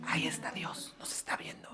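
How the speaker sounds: susurrando escondido bajo el árbol; susurro escondido. Acted. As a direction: dilo en secreto, sin voz. Adán escondido, susurra de Dios. (susurro de quien se esconde)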